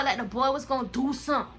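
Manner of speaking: usual speaking voice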